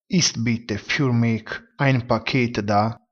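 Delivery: frase tedesca detta piano, parola per parola; lento e scandito. Shown as a demonstration. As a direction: leggila lentamente per chi impara. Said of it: "In tedesco si dice". (frase tedesca scandita lentamente per gli studenti)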